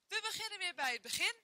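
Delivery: usual speaking voice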